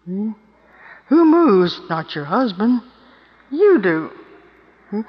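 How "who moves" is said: pointed loud question. Acted. Rhetorical challenge to women.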